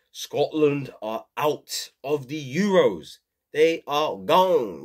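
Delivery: put-on slow, drawn-out Scottish accent; a fan's lament in a Scottish accent. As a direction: mourn Scotland's exit in a Scottish accent. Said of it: "Tell me how my Scottish accent was".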